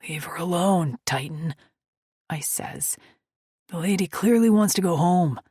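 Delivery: loud, firm biker rebuke; firm warning. Acted. Biker telling Titan to leave her alone, firm. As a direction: tell your buddy to back off.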